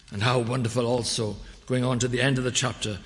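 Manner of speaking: ordinary talk, nothing marked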